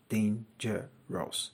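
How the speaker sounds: dangerous said slowly, syllable by syllable; teacher spelling out dangerous slowly. Shown as a demonstration. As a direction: stretch out dangerous so each syllable is heard. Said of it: "it's more than two syllables".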